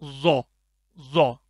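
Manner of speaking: ज़ो said thick and firm; a hard, full ज़ो; short heavy ज़ो. Shown as a demonstration. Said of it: "मोटा करके भी पढ़ना है".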